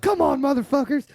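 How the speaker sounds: cocky bar taunt at the bikers; challenging taunt. Retold or imitated. Challenging bikers to fight, retold.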